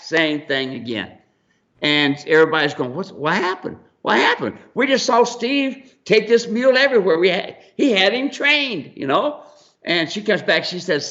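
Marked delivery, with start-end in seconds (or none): 2.9-9.4 high, puzzled onlookers' voice; mock baffled crowd. Onlookers asking what happened to the mule, high and baffled. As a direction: act out the bewildered crowd, pitched up.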